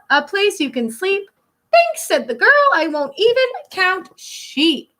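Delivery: put-on lilting read-aloud for kids; storybook reading. Teacher reading a rhyming picture book to children, lilting. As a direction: read the rhyme to a class.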